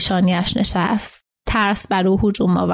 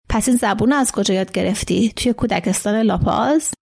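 usual speaking voice

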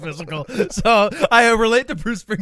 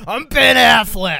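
mock Boston accent; 5 semitones higher, 9 dB louder, slower than usual. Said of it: "he always goes".

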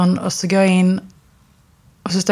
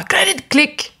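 much higher, mock Chinese-accented English; imitating a Chinese accent; 10 semitones higher, much slower than usual. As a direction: impersonate the Chinese landlady's accent, pitched way up. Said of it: "en kinesisk tjej som svarade med en brytning".

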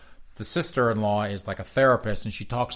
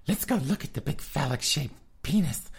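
mock camp queen; 5 semitones higher than usual. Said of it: "All these queens were going up there like".

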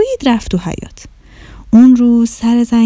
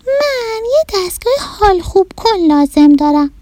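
little rabbit; 9 semitones higher than usual. Acted. Little rabbit telling the mouse, high sad child voice. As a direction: play a small sulky bunny, pitched way up.

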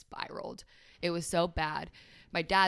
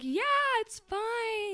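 much higher, slow and breezy mock dismissal; brushing it off; much slower than usual. Mocking her past self to friends who worried, breezy brush-off. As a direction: wave off the worry in a high sing-song. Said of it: "And I'd be like".

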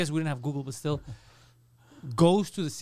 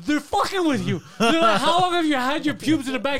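high, loud incredulous outburst; exasperated yelling; 9 semitones higher, 7 dB louder than usual. Retold or imitated. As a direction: shout it like a clerk who can't believe this. Acts out exasperated clerk's outburst.

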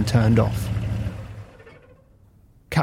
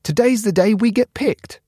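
high, hopeful cheer; thrilled little voice, pitched up; excited; 9 semitones higher than usual. A narrator's reading. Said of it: "Cap said".

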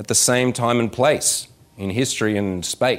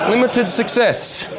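much higher, drawn-out mock complaint; mock objection; 11 semitones higher, slower than usual. Audience member objecting to the Timmy method, mock objection. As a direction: stretch it out as a comic protest.

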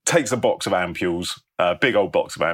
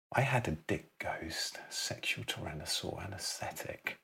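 hushed, breathy whisper to himself; 14 dB quieter than usual. Acted. Elliot in the cinema, whispering his idea to himself. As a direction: whisper the pieces together like a secret.

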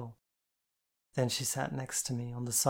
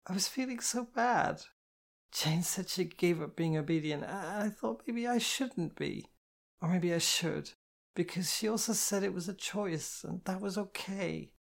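put-on higher, pleading girlish voice; tearful girl; 7 semitones higher than usual. A narrator's reading. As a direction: spill it all out, drunk and emotional. Kitty voiced by narrator.

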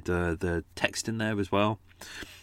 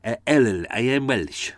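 hammed-up, bad Welsh accent; mock a crappy Welsh accent, loud and overdone; exaggerated fake Welsh singsong; awful Welsh accent; 3 semitones higher, 4 dB louder than usual. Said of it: "people do a really awful Welsh accent".

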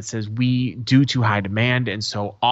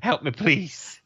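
mock despair; 4 semitones higher than usual. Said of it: "I was like".

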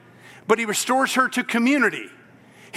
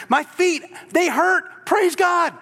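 excited; 7 semitones higher, slower than usual. Acted. Healed man voiced praising.

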